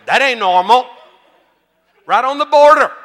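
loud, high holler; preacher's shout. Real, not acted. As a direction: belt it out to the whole congregation. Preacher calling out to congregation.

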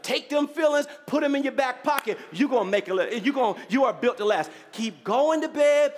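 impassioned preaching. Real, not acted. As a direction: build to a rousing declaration for the congregation. Preaches to the congregation.

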